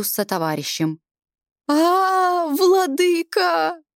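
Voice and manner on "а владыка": high, slow wailing cry; wailing lament. A narrator's reading. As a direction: wail to the lord in despair. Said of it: "запричитал он".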